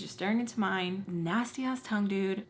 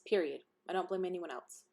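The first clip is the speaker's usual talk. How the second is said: normal talking voice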